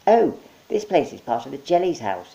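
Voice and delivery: high, cheerful monkey; chirpy host monkey. Acted. Monkey welcoming Mike with potato hands.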